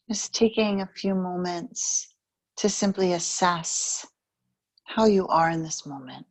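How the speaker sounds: calm meditation guide, unhurried with long pauses; guided meditation. Real, not acted. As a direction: ease the listener into stillness, evenly and gently.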